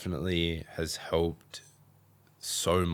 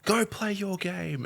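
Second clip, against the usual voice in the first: high, slow command; mum's firm order; 13 semitones higher, slower than usual. Retold or imitated. Mum sending son back to play: high slow order. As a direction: lay down the law slowly. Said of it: "she's like".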